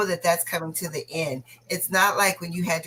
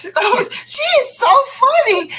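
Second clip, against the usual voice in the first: loud, high burst of amusement; laughing delight; 8 dB louder, slower than usual. Real, not acted. Co-host amused by a viewer's comment, laughing delight. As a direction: squeal it through real laughter.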